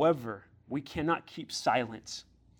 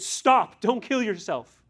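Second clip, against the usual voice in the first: loud, much higher shout; Paul shouting to stop the jailer from killing himself, loud; urgent shout; 7 semitones higher, 7 dB louder, slower than usual. Acted. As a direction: yell it to stop him. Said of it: "N L T says that Paul shouted".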